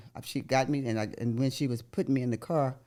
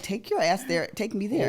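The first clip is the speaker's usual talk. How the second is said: higher, bossy mock command; retold bossy order; 8 semitones higher than usual. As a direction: order her straight to the hospital.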